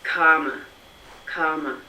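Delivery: "karma" slowly, with no R sound; long open vowel in "karma", R left silent; non-rhotic British "karma", said as a demonstration; British dropped R. Shown as a demonstration. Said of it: "So I would say".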